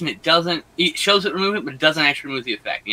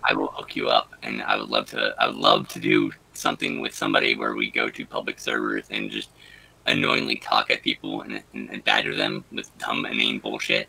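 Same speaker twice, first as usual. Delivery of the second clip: very low, growly altered voice; deep voice changer; 4 dB quieter than usual. Acted. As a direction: speak through a deep voice filter. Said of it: "sounds like you need to clear your throat".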